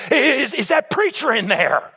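high, drawn-out, stammering mock fright; cowering. Cowering customer asks about the preacher, mock fearful. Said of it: "she was cowering in her car".

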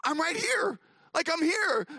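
high-pitched protest as the poor dog; bewildered dog's voice. Retold or imitated. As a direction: call out as the overlooked dog. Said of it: "he's probably just thinking".

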